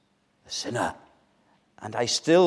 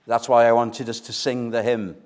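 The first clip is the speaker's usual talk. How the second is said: ordinary talk, nothing marked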